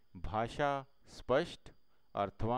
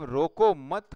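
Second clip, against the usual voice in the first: "रोको मत" quoted loud and raised in pitch; stress on रोको मत; 4 semitones higher, 9 dB louder, slower than usual. Shown as a demonstration. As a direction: hit "रोको मत" firmly as one phrase. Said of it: "कहा".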